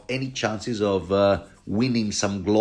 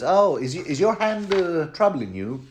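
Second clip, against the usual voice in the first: higher, oily fake-concerned voice; mock Qyburn; 8 semitones higher than usual. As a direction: play Qyburn asking with false sympathy. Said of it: "He goes".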